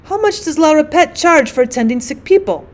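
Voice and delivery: usual speaking voice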